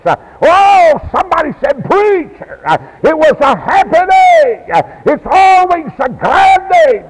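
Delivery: high, loud shouted preaching; full-force preaching. Real, not acted. Preacher rejoicing with the congregation, full-force shouted preaching.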